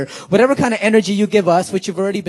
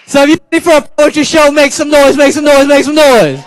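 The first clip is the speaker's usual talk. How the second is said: much higher, louder hype-man chant; hype call; 10 semitones higher, 12 dB louder than usual. Acted. Poet whips the school audience up for the show, loud hype chant. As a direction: pump up the crowd, building each repeat. Hyping the crowd for noise.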